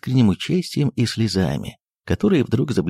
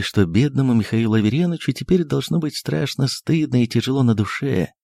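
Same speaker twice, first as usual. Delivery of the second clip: plain reading voice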